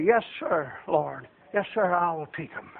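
low, very soft compliance; humble submission. Acted. Believer accepts the Lord's orders, low humble reply. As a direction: answer God quietly, like an obedient soldier. Models submissive answer to God.

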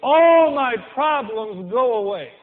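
slow, loud mocking escape line; mock drinker. Preacher mimics a drinker escaping problems, slow and loud. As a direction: drawl it like a drinker. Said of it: "You know why I drink?".

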